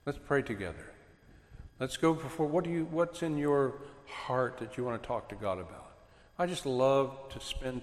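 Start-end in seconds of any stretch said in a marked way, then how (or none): 0.0-6.0 softer, tender call to kneel and pray; soft, tender parent inviting a child to pray together; gentle invitation. Retold or imitated. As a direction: quietly welcome the child in beside you. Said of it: "and you go".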